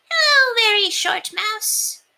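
cheerful lanky mouse; tall mouse. A narrator's reading. Very Tall Mouse greeting friend, high and slow.

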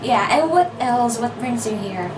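ordinary delivery

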